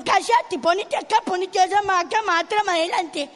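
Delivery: loud rapid-fire commands; bossy ordering. Acted. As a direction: push him into place with orders. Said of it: "Lo quería acomodar". (órdenes mandonas en voz alta)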